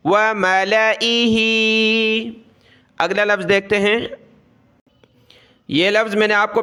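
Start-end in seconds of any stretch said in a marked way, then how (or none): 0.1-2.4 very slow syllable-by-syllable reciting; letter-by-letter recitation. Shown as a demonstration. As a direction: spell out each sound slowly like a teacher. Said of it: "छोड़ देना है".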